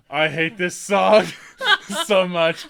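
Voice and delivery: slow, loud laughing complaint; laughing exhausted whine. Retold or imitated. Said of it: "sitting on the ground".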